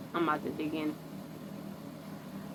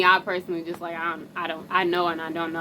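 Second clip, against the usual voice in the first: ordinary delivery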